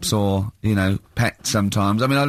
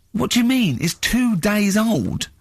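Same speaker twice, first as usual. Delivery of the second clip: higher, incredulous, slowing on the facts; pitched-up mock exasperation; exasperated disbelief; 10 semitones higher, slower than usual. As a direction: spell it out to a fool. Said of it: "I went".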